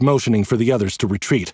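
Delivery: ordinary delivery, nothing marked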